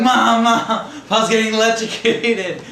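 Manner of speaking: put-on high, loud, drawn-out child's wail; mock panicked yelling. Scaredy-cat kid runs inside to mom, panicked yell. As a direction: holler for help in exaggerated panic.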